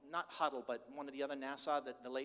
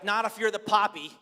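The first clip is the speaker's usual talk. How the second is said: loud, high proud declaration; a proud grandfather defending himself; proud grandpa; 4 semitones higher, 13 dB louder than usual. Retold or imitated. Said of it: "I was like".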